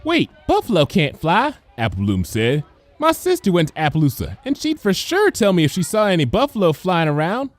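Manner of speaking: put-on high childlike question; curious filly. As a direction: point and ask like a small curious girl. Applebloom objects.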